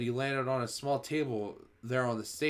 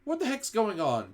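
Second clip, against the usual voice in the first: put-on higher, puzzled boy's voice; a boy starting to worry; uneasy boy; 9 semitones higher, 3 dB louder than usual. A narrator's reading. Bruno uneasy on stage.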